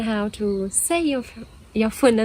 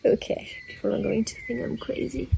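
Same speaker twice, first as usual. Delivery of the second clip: whispering; 6 dB quieter than usual. Real, not acted. Embarrassed aside.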